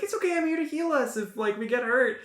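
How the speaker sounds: high, light cleric voice. Acted. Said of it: "And the cleric's like".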